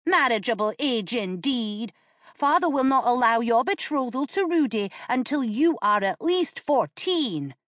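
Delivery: put-on high, scornful kid brother; mocking boy. A narrator's reading. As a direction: scoff at her claim. Boy mocks sister's claim.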